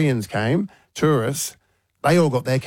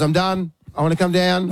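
imitating little daughter; 6 semitones higher than usual. Dad voicing scared daughter.

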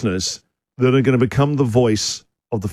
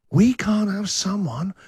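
slow, higher, thin raspy voice; raspy weedy voice; 6 semitones higher, slower than usual. Acted. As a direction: put on a weedy, raspy croak. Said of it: "a raspy, weedy voice".

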